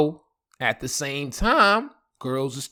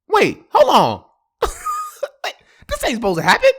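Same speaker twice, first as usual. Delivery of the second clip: high, loud mock disbelief; shocked protest; 7 dB louder, faster than usual. Man learning his ex moved on, comic shocked protest. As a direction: squeal it out in hammed-up shock.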